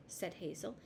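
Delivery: usual speaking voice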